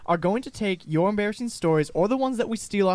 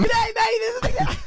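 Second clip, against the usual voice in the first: manic squealed Aussie greeting, extremely high; crazed, very high mock intro; going mad; 3 dB louder than usual. Host who can't be stopped repeating his intro, going mad. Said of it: "He's going mad".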